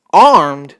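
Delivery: loud, stunned single word; can't believe it; shocked disbelief. Real, not acted. Reader echoes the shocking word, loud disbelief.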